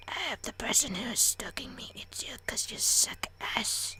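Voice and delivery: soft, hammed-up whispered rant; hushed, bitter mock cursing; resentful whisper. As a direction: whisper insults under your breath. Whispered resentful aside to parents.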